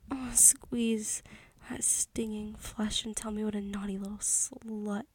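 usual speaking voice

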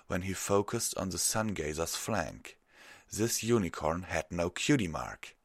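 ordinary delivery

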